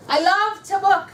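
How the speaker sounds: loud, high, drawn-out rallying chant; powerful affirmation. Shown as a demonstration. Trainer leads consultants in an affirmation, powerful and emphatic. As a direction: lead the room in a big slow affirmation. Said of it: "repeat after me with the same inflection".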